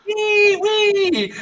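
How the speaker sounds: put-on very high squealing kid voice; screaming kids. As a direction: squeal like children running around wild. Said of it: "just screaming kids or something".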